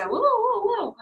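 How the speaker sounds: high, loud whoa, whoa; mock alarm. Levels in the body suddenly spiking, crying out in mock alarm. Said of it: "the levels go".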